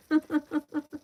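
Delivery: short, high burst of laughter; amused giggle. Real, not acted. Streamer amused by viewers in the chat, high giggle. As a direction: break into a real, high giggle.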